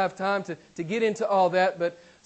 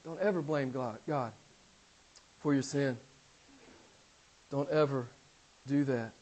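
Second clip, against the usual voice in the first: softer, lower tender warning; quiet sincere plea; 5 semitones lower, 5 dB quieter than usual. Real, not acted. Preacher softly warning a friend not to blame God. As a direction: lean in and plead softly.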